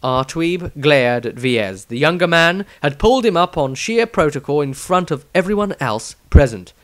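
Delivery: usual speaking voice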